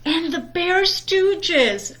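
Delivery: put-on high, slow child voice; little sister cub. Sister adds her show, high and slow. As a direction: drag it out like a pleading cub. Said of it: "added Sister".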